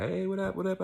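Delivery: high, sing-out hello; acted upbeat greeting. Acts out greeting coworkers.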